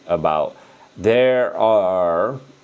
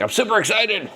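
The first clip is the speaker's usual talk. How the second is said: excited; 14 semitones higher than usual. Retold or imitated. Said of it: "a lot of people that are excited".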